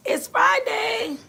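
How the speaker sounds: high and slow, "It's Friday" drawn out in a lilting tune; sing-song Friday. Real, not acted. Friday announced twice.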